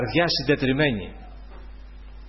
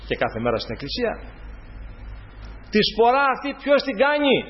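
higher, forceful preaching; 4 semitones higher than usual. Acted. Preacher questioning the faithful, in raised preaching voice. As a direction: press the questions hard like a preacher.

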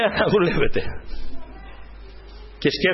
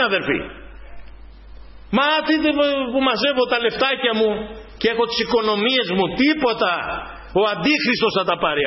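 raised, insistent sermon voice; preaching; 5 semitones higher than usual. Acted. Preacher urging the brothers and sisters not to lose their goal, fervent preaching. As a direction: preach it to the faithful with fervour.

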